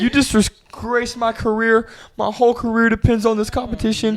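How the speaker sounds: high, loud, wailing reproach; mock tearful accusation. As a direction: imitate a distraught teacher crying over a ruined career.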